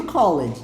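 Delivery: "called" read as two syllables, "call-ed"; spelling pronunciation of "called"; said as spelt. Shown as a demonstration. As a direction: sound out the E in "called". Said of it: "Tá escrito called".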